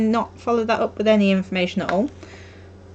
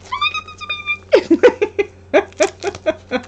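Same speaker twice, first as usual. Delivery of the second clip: squeaky grateful gush, far higher; really high-pitched; 7 dB louder than usual. Retold or imitated. Crafter retelling her thanks to her friend, very high-pitched. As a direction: go way up high like you can't contain your thanks. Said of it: "In that really high-pitched voice".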